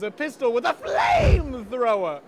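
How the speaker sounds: excited loud sales pitch; hyped shout. Acted. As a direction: sell it like a TV ad. Ad hype peak.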